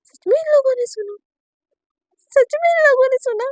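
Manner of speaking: can't believe they heard it; shocked disbelief. Acted. Naina aghast that her parents heard, shocked.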